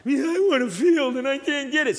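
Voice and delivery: a lightly voiced pouting whine; mild mock sulk. Ahab answering Jezebel, a light pout. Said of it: "what are you pouting about?".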